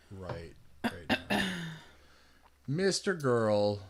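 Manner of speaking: ordinary talk, nothing marked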